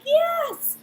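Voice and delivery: a high, loud cheer; thrilled shout at hearing she'll have the baby today; ecstatic yes. Retold or imitated. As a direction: whoop it with pure joy. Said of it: "And I'm like".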